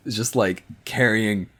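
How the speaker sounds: ordinary talk, nothing marked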